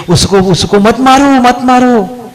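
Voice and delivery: high pleading yell, last words long drawn out; storyteller's stretched-out cry of protest; imitated crying out. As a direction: call out to stop a beating, stretching the end. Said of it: "कह रही थी".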